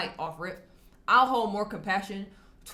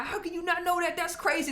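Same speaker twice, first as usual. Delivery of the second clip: much higher, incredulous mock scold; mock aggressive; 9 semitones higher than usual. Vlogger acting out how he won't talk to people, mock aggressive. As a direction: play the rude know-it-all, hammed up. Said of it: "I don't be aggressive and be like".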